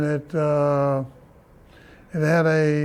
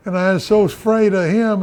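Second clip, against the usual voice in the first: normal talking voice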